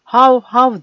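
high, loud laughing imitation of a dog bark; mocking bark. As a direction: bark at him in mockery. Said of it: "diyordu Vasos gülerek". (yüksek, gürültülü alaycı köpek havlaması taklidi)

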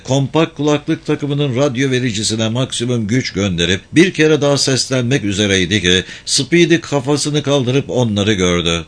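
ordinary delivery, nothing marked

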